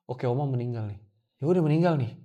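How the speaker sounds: low, subdued self-talk; retold numb emptiness. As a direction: let it drop, empty and at a loss. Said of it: "Itu kosong aja".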